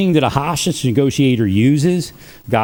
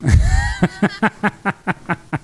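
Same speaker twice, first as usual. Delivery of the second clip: long high laugh in quick bursts; real rattling laughter, pitched high; delighted laugh; 8 semitones higher than usual. Real, not acted. Tickled by his own names, long delighted laugh.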